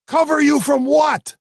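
high loud called-back question; shouting back. Acted. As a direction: shout across the room. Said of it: "the bartender shouted back".

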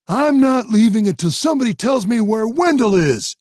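high loud stubborn yell; defiant shout. Acted. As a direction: stand your ground. Jimmy defiant in fight.

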